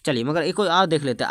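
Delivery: usual speaking voice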